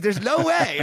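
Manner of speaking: laughing off his own price in disbelief; incredulous. Retold or imitated.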